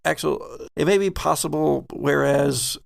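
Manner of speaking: ordinary delivery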